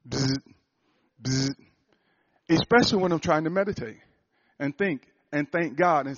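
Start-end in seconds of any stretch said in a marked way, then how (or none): 0.1-1.6 imitated phone buzz. Preacher imitating his phone buzzing. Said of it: "my phone is going bzz".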